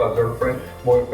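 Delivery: normal talking voice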